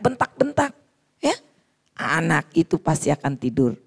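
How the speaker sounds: ordinary talk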